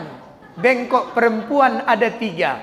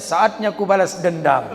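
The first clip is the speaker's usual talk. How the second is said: low, menacing gloat; a mock villain's low gloat; vengeful wife; 8 semitones lower than usual. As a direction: savour the revenge darkly. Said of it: "Datang bininya".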